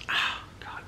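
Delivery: whispered wince of pain. Real, not acted. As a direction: breathe it out in pain. Tooth pain, quiet pained gasp.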